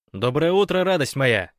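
high, loud wake-up greeting; loud morning greeting. A narrator's reading. Teammate to sleeping Rogalik, a loud good-morning.

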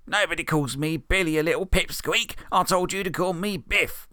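Billy taunting Morty, sneering; bully Billy. Acted. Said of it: "said Billy".

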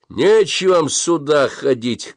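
a stranger's rough slow yell; shout from the back. A narrator's reading. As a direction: call it out from far back in the queue. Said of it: "крикнул кто-то из глубины".

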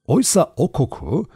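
plain reading voice

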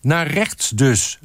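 high, slow called-back check; calling out. A narrator's reading. Group member checking the photographer's direction, calling out. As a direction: call it back, drawn out. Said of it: "riep Huub Pastoors".

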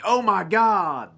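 loud, high, drawn-out cry; mock announcer yell. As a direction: imitate a commentator yelling a big moment.

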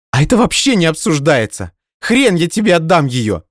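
high, loud defiant voice; indignant retort. Acted. Nadya refusing Dima, high loud retort. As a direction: snap back defiantly.

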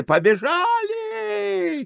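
very high, long-drawn start call; desperate cry. Acted. Desperate voice in the carousel, high drawn-out cry. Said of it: "отчаянный голос в карусели".

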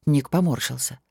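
ordinary delivery

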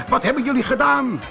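high, fast outraged demand; screaming. Acted. Meneer Vet confronts his gang, high rapid yell. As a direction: yell the question quickly and high. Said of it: "schreeuwde hij".

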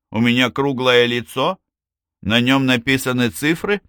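irritated retort, pitch climbing on each question; snapping back. A narrator's reading. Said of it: "огрызнулся воин".

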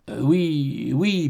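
slow, soft, hesitant yes; stammering. A narrator's reading. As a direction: falter softly, drawing out the words. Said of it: "bégaya-t-il". (bégaiement hésitant, lent et doux)

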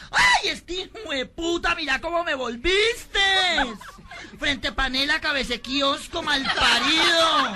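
high, loud, drawn-out comic tirade; outraged yelling. Acted. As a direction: stretch each insult into a hammed-up screech. La loca empapada insulta al conductor. (indignación chillona y exagerada)

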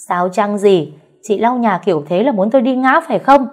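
loud, high accusing voice; a mother-in-law angrily accusing her daughter-in-law. Acted. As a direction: throw the blame back at her.

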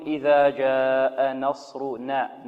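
إذا جاء نصرنا slowly recited with a heavy ص; slow Quranic recitation, full ص; correct recitation. Shown as a demonstration. Teacher reciting the verse slowly for the student. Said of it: "إذن هنا نقول".

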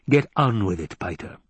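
plain reading voice

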